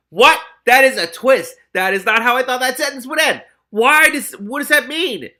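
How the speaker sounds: real astonished shouting; shocked outburst. Real, not acted. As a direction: react loud to a twist you didn't see coming. Reader shocked by plot twist.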